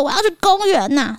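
put-on whining child. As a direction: play the stubborn kid begging. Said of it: "我一直说".

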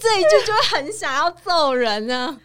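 loud and high, fed up; exasperated shout. Real, not acted. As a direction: shout how infuriating it is. Reacting furiously to the line.

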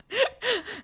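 quiet high falling laugh; a breathy chuckle; soft high laugh. Real, not acted. Host laughs softly at the stubborn boyfriend.

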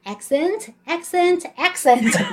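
slow triple repeat like a language drill; English-drill imitation. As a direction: chant it out slowly, word by word. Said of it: "以前那个暴龙英文这样". (模仿暴龙英文，慢慢念三遍)

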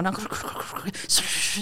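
用气音很轻地模仿零食倒进桶里的咕噜声; 压低声音，像零食哗啦倒进爆米花桶那样; imitated pouring noise. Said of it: "咕噜咕噜倒进那个爆米花桶". (在电影院里轻声模仿倒零食的咕噜咕噜声)

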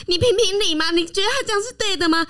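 high, whiny plea; begging the parent to take sides; pleading to a parent. Retold or imitated. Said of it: "找一个公道的人来出来说".

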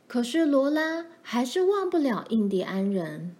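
ordinary delivery

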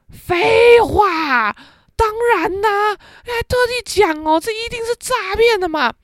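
scoffing dad. Retold or imitated.

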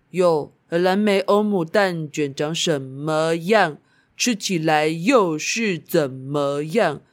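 slow, solemn poem recital; reciting a poem. Acted. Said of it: "来念首诗好了".

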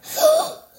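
high, loud sudden gasp of realisation; sudden shocked gasp; sharp gasp. Acted. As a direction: gasp as it all clicks. Suddenly remembers.

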